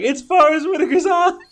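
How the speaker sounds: high, loud, gleeful squeal of recognition; excited realization. Retold or imitated. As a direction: shout it out, thrilled to be right. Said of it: "I'm like".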